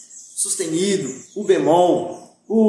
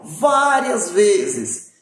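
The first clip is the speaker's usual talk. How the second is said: várias vezes stretched out very slowly, each word leaned on; teacher insisting the students write the notes várias vezes, slow and emphatic; much slower than usual. Shown as a demonstration. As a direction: drag out várias vezes to drive it home. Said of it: "não uma, não duas, várias vezes".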